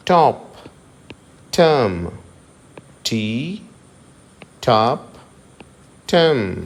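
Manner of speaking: టర్మ్ with no r sound; silent r. Shown as a demonstration. Teacher models t words with silent r. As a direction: say టర్మ్ as టమ్. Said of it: "ఇక్కడ కూడా ఆర్ silent".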